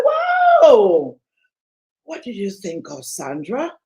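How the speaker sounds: shocked parent. Acted. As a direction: play the alarmed mother hearing bad news. Parent's shocked reaction quoted.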